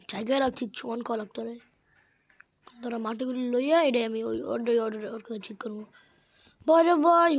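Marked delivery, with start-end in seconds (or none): 4.9-5.8 whispered aside to himself. Real, not acted. 6.7-7.3 loud, high exasperated cry. Real, not acted. Exclaims at a game mishap.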